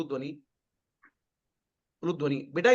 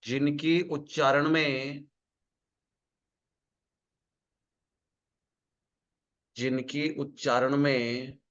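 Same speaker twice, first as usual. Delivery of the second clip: lower, slow dictation, phrase by phrase; 8 semitones lower, much slower than usual. Acted. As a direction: dictate each phrase slowly so students can write.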